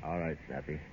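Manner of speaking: swallowing the hurt, almost under his breath; crushed and hushed. Acted. Heartbroken farewell to friend.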